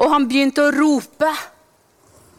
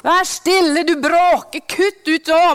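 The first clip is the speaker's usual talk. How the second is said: slow, sharp scolding to silence him; angry crowd hushing; 4 semitones higher, 3 dB louder than usual. Retold or imitated. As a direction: crowd telling the blind beggar to shut up. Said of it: "begynte folkene rundt han å true han".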